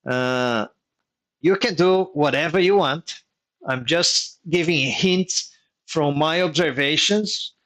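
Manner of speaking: normal talking voice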